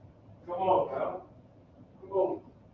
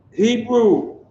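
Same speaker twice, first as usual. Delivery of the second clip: loud call; 8 semitones higher, 8 dB louder than usual. Real, not acted. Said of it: "teacher calls out the word".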